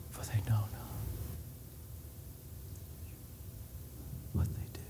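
breathless dying whisper; barely voiced, out of air on the cross. Shown as a demonstration. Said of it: "It's a whisper".

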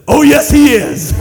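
dad catching sons with a laughing retort; loud laughing retort. Retold or imitated. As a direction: catch them out with a booming gotcha. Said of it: "And I said".

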